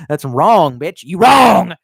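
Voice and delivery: loud shout swelling on the last word; mock outburst. Getting carried away in a retold argument, mock shouting. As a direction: yell it out in mock indignation. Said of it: "contain yourself".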